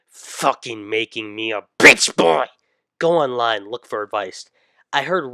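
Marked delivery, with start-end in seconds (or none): 0.1-2.5 angry outburst. Acted.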